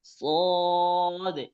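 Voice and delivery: স দে said thick and heavy from the throat; heavy emphatic sad. Shown as a demonstration. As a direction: give the স a full, dark, heavy sound. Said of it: "একটু মোটা করে উচ্চারণ করতে হবে".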